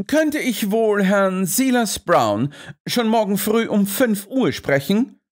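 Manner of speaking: a hint of a gentleman's voice; polite Holmes. A narrator's reading. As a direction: ask very politely, taking your time.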